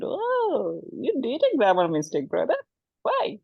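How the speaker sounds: high mocking squeal; mock a friend's mistake with a shrill high whine; teasing friend. Bangladeshi friend mocking a grammar mistake, high teasing voice.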